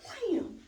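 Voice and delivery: high, loud "wham"; imitated impact. Storyteller acting out mom grabbing Karen, loud high sound effect.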